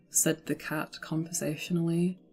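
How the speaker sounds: usual speaking voice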